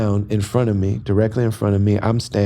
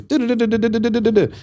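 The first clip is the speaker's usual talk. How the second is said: mock foreign chatter; 12 semitones higher, faster than usual. Mimicking fast Turkish speech.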